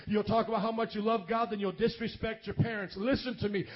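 ordinary delivery

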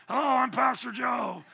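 put-on shouty voice at modest volume; mock-shout it like a catchphrase impression; hammed-up, strained impression of a hoarse preacher; Pastor Joe imitation. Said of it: "do a Pastor Joe imitation".

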